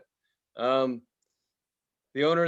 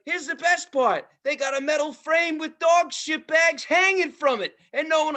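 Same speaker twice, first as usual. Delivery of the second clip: ranting phone call; 12 semitones higher than usual. Retold or imitated. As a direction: imitate the owner ranting on the phone. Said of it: "she's like".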